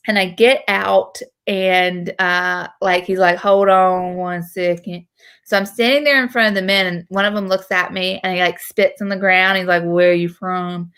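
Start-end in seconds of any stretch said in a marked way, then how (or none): 3.4-5.1 slow South Carolina drawl; slow, mumbled Southern twang; thick Southern accent. Retold or imitated. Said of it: "that thick Southern, like, South Carolina accent". 9.8-10.9 slow South Carolina drawl; drawn-out backwoods Southern accent; swamp Southern accent. Retold or imitated. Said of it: "specific type of swamp South Carolinian person".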